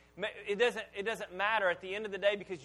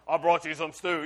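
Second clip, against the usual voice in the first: disguised voice; 2 semitones lower, 7 dB louder than usual. Retold or imitated. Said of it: "That doesn't sound like my son Esau".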